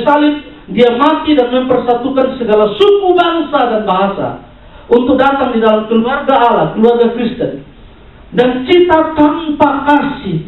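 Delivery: high, sustained exhortation; impassioned sermon pressed phrase upon phrase; forceful preaching. Real, not acted. Preacher warning those who split the church, forceful preaching.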